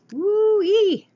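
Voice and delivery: high, loud happy whoop; excited whoop. Real, not acted. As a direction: whoop with delight at the card. Reacting to a drawn card.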